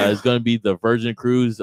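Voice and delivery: usual speaking voice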